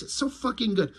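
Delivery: usual speaking voice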